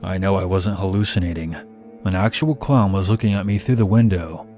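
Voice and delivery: ordinary delivery, nothing marked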